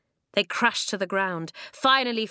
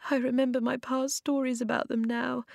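through tears; 5 semitones higher than usual. A narrator's reading. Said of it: "said through the tears".

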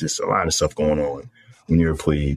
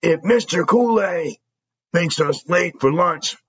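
angry dressing down; 12 semitones higher than usual. Retold or imitated. Squad leader yelling.

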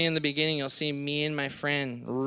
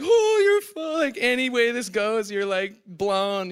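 shocked exclaim; 5 semitones higher, slower than usual. Retold or imitated. Friend Andy reacts to broken board.

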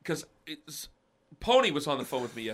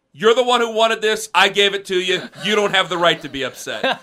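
loud, higher, reenacted snap at a pregnant wife; angry snap at wife; 5 semitones higher, 7 dB louder than usual. Husband retelling the snap at his pregnant wife, loud and angry. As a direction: bark it like the fight he regrets. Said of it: "I said something to the tune of".

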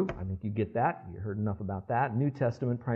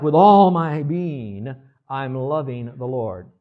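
louder, slow fervent vow on all my being, easing at the end; heartfelt devotion; 8 dB louder, slower than usual. Acted. Preacher showing congregation wholehearted love, slow fervent vow. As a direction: pour your whole self into every word. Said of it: "So it's kinda like".

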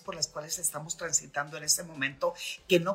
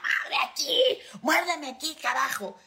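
much higher, fed-up exclamation; exasperated demand; 10 semitones higher than usual. Shown as a demonstration. After years of waiting, fed-up demand to partner, much higher. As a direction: lose patience and cry out the demand. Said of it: "ya al tercer año le digo".

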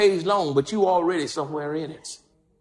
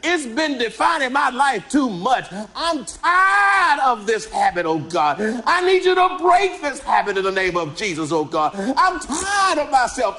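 acted prayer cry; 5 semitones higher than usual. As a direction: cry out to God, desperate. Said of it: "You cry out".

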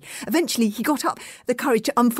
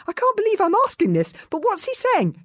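bewildered; 12 semitones higher than usual. Acted. Fishlegs bewildered.

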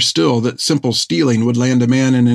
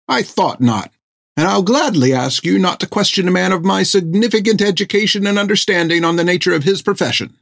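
a prim, affronted physician, pitched higher; indignant fussy doctor; 8 semitones higher than usual. Acted. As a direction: scold the captain with offended dignity. Thiggum's lofty rebuke.

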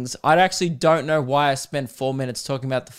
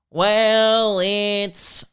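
jingle start; 5 semitones higher than usual. Shown as a demonstration. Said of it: "I'll give you one more".

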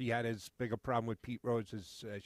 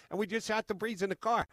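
normal talking voice

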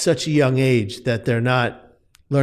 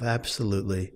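hopeless self-talk; 5 semitones lower, 8 dB quieter than usual. Retold or imitated. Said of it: "I said to myself".

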